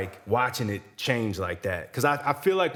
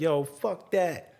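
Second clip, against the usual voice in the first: higher-pitched, drawn-out mock defiance; retold rant; 6 semitones higher, much slower than usual. Rapper defying venue staff over smoking, retold rant. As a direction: imitate a defiant rant, stretched out. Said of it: "he continues his rant on side stage".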